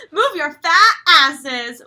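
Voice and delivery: loud, slow, laughing yell; mock yell. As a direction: holler it at the cows. Repeating Nicole's yell.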